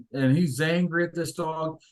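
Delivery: ordinary talk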